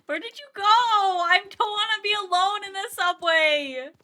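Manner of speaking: high, loud scared plea; scared whining. Real, not acted. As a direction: beg not to be left alone. Left alone, scared in game.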